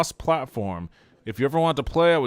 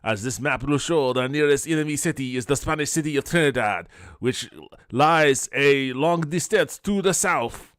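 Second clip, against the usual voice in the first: raised, emphatic mock French accent; 4 semitones higher, 5 dB louder than usual. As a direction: proclaim it as an official with a rough French accent. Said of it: "doing the French accent or not".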